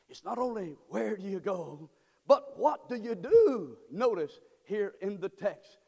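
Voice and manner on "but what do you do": high, loud, slow preacher's cry; full-force preaching. Acted. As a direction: call it out slow and loud to the congregation.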